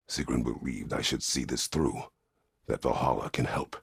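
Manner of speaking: ordinary talk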